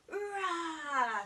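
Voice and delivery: put-on teacher roaring like a lion for kids. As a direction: roar like a friendly lion. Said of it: "a big push-up and a roar".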